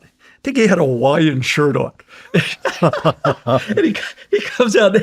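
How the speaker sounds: usual speaking voice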